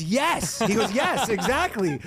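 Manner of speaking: high, laughing agreement; high eager yes with a laugh; imitating the guy.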